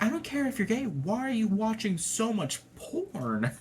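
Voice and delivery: retelling the dad's outburst. Retold or imitated. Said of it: "He does".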